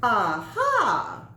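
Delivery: loud triumphant cackled Aha; cackling aha. Acted. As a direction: crow Aha like a gleeful witch. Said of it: "cackled the sandwich".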